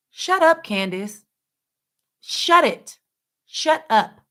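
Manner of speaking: high, loud dismissive shut up; exasperated shut up. Real, not acted. Vlogger telling Candace to shut up, high and fed up.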